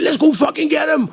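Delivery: loud shouted challenge. Retold or imitated. The buyer to the narrator, shouting to go fight. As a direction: yell it, ready to fight. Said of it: "He said".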